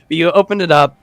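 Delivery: usual speaking voice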